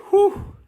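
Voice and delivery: high sigh of relief; relieved whew. Acted. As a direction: let the breath out like you just escaped. Relief after teacher passes.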